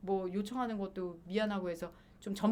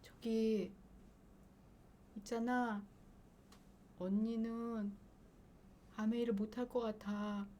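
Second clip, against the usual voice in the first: quiet, hesitant, nervous refusal; timid trembling voice; trembling timidly; 8 dB quieter, slower than usual. Retold or imitated. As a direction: softly back out of it, scared. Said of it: "그냥 벌벌벌 떨면서".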